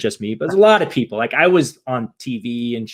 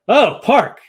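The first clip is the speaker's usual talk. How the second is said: put-on drunken sigh; 10 semitones higher, 6 dB louder than usual. Drunk runner reaches the park.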